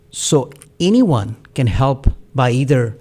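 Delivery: normal talking voice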